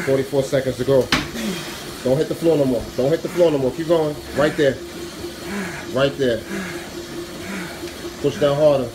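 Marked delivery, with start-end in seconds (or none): none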